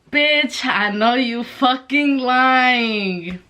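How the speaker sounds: incredulous, long-drawn mock outrage; drawn-out disbelief. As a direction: stretch the words out, leaning on fucking. Retold comeback, drawn out in disbelief.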